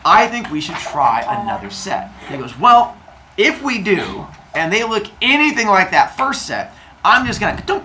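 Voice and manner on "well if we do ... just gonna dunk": high, emphatic mock ultimatum; warning condition. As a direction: lay down terms to a wife. Father Sky lays down his condition.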